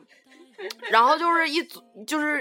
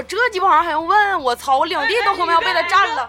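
higher and louder mock outrage; indignant retort; 8 semitones higher, 8 dB louder than usual. Man retelling his furious answer to his girlfriend, indignant. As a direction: rant it like you're still fed up.

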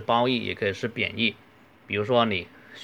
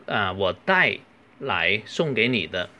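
ordinary delivery

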